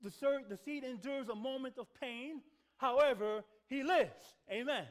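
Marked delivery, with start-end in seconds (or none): 2.8-4.9 triumphant. Real, not acted. Preacher's triumphant climax.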